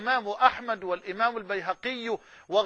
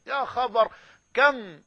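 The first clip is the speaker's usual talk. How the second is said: ordinary talk, nothing marked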